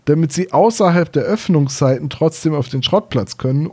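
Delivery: normal talking voice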